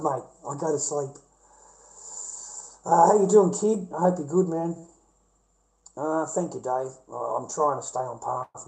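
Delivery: ordinary talk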